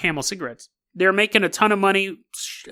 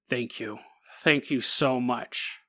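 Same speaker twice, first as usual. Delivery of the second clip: much softer, slow, reverent thank you; a guest quietly stunned by the gift, much softer; hushed thanks; 7 semitones lower, 9 dB quieter, slower than usual. Acted. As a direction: breathe out the thanks in awe. Guest receiving meth gift.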